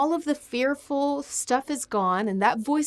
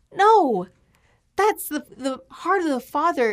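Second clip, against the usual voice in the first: higher and louder, fervent insistence; emphatic preaching; 6 semitones higher, 6 dB louder than usual. Real, not acted. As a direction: drive the point home with fire. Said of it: "preaching climax".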